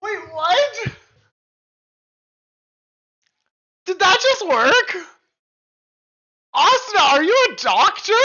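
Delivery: high, loud astonishment; can't believe it worked; amazed. Real, not acted. Streamer amazed the hiccup cure worked, asking Asuna.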